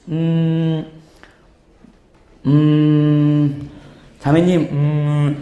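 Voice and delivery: low, drawn-out hums between words; hesitant beating around the bush. Shown as a demonstration. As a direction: stall slowly with long 'um's. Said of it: "제가 막 빙빙 돌려서 말하는 거". (빙빙 돌리며 뜸 들이는 말투)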